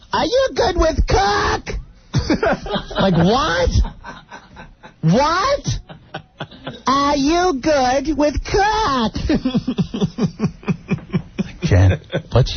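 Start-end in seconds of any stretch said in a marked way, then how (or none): 0.1-1.7 put-on high, slow old-lady quaver; mother-in-law voice. Mimicking the mother-in-law's question in a high quavering voice. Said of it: "she goes". 6.9-9.1 put-on high, slow old-lady quaver; mother-in-law voice. Mimicking the mother-in-law's question in a high quavering voice. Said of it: "she goes".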